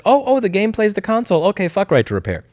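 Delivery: high, dopey politician parroting the claim; mocking a clueless politician. Politician mocked.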